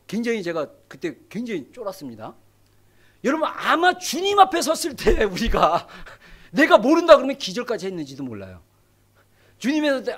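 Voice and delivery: ordinary talk, nothing marked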